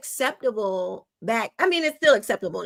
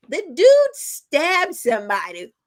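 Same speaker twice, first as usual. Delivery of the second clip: ordinary delivery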